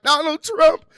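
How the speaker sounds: hammed-up whining over what he said; mock flustered complaining. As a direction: play the frustrated voter griping about every quote. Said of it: "be like".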